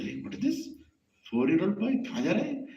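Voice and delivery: high-pitched astonished questioning; surprised questioning. Acted. Sage spotting a four-year-old alone in the forest, surprised.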